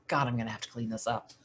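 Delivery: soft, fast grumble under the breath; muttered grumble. Retold or imitated. Lucy grumbling to herself about cleaning up the mess, muttered. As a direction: mutter it to yourself, quick and low-volume.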